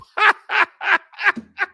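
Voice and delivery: a loud, high burst of laughter; big laugh. Real, not acted. Host laughs at his own Basic Cable joke, loud high laughter.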